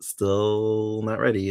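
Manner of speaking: very slow, drawn-out "still"; mock reluctant stalling. Host imitating Chris dodging Sandy's declaration, slow and reluctant. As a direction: stretch it out like an awkward dodge.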